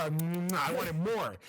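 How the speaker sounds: slow hungry drawl; slow, drawn out. Shown as a demonstration.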